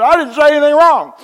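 put-on very high, loud childish protest; hammed-up tantrum voice; foot-stomping whine. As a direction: whine like a kid stamping his foot. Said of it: "boy, I stomped my foot".

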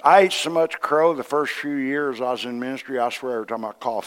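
normal talking voice

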